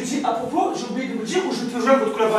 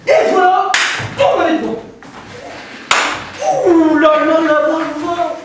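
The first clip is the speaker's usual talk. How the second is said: louder, higher, crowing; triumphant gloating; 3 semitones higher, 4 dB louder than usual. Real, not acted. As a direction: crow over the fooled writer. Gloating after the prank.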